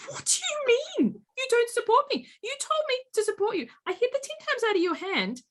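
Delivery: high, puzzled mock husband voice; bewildered husband. Husband protesting to his crying wife, high and baffled. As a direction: play him baffled and defensive. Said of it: "And he's like".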